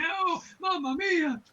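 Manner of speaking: high, slow mock Italian American lament; hammed-up Italian American wail; Italian American sandwich guy. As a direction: stretch it out like a cartoon Italian American shopkeeper. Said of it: "a guy in a bodega making sandwiches going".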